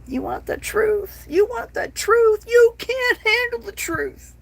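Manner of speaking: high, loud, shouted courtroom rant; Jack Nicholson impression. Mocking TikTok's censors with a loud, high Nicholson yell. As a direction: bellow it like the famous movie colonel losing it on the stand. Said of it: "In my best Ni-, Nicholson voice".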